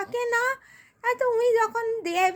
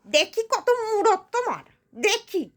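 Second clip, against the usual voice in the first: sharp, swooping, sarcastic complaint; scornful poor woman. Acted. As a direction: scoff bitterly, then grudgingly challenge them to deliver. Poor woman scoffs at birthday celebration.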